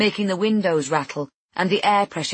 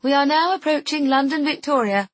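clipped female announcer, much higher and louder; intercom announcement; 9 semitones higher, 4 dB louder than usual. Acted. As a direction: announce over the train intercom. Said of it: "A clipped female voice comes over the intercom".

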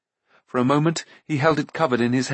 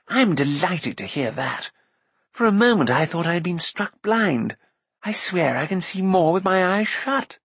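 higher, lilting bard voice; voluble bard; 6 semitones higher than usual. Acted. Bard to companions in the dark, relieved. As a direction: exclaim with rueful relief.

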